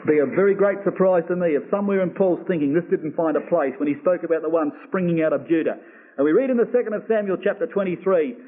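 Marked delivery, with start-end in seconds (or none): none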